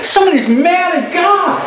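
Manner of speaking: wife's remark, barely acted; quoting his wife. Wife remarks during a chat, lightly quoted. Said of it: "and says, uh".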